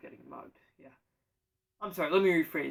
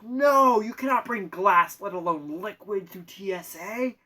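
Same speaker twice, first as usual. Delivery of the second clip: agent yelling; 3 semitones higher, 9 dB louder, slower than usual. Retold or imitated. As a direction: shout the refusal at the traveler. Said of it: "she yells at me again and goes".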